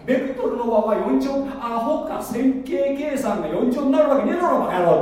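mock the nodding crowd, then sneer; mocking yes-men. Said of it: "お互いに".

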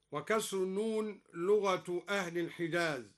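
ordinary delivery

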